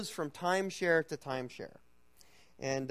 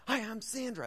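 Sandra's greeting; 9 semitones higher than usual. Retold or imitated. Drunk Sandra introducing herself.

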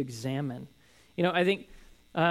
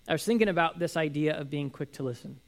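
ordinary talk

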